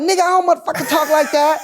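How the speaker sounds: very high, loud imitated protest; mock indignant denial. Comedian denies how she talks, imitated high and loud. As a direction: imitate her protesting at the top of the voice.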